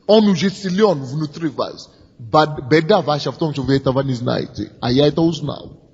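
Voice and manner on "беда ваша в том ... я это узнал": usual speaking voice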